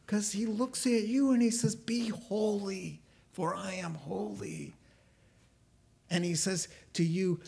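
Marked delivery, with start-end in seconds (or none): 1.8-4.7 solemn voice of God. Acted. God looks at you and speaks, solemn.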